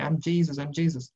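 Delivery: ordinary talk, nothing marked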